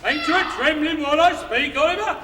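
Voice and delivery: loud threatening call; menacing. Acted. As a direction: boom it through the door. Beadle threatens through door.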